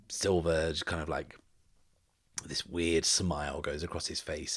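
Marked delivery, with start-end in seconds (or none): none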